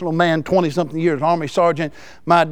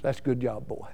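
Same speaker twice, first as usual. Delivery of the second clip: gentle praise; 6 semitones lower, 11 dB quieter than usual. Retold or imitated.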